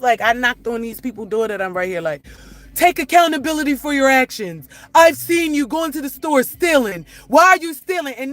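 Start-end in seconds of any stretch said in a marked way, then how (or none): 2.8-8.1 higher, louder scolding imitation of her critics; mocking preachy lecture. Mother mocking online critics who lecture her, scolding mimicry. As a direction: throw their sanctimonious words back at them.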